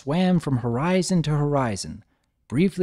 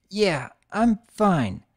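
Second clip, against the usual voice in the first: slow, forced toughness; holding back tears; 6 semitones higher, much slower than usual. Acted. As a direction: pretend to be fine through tears.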